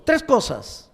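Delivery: usual speaking voice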